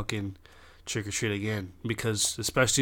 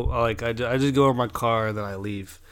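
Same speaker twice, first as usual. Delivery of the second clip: normal talking voice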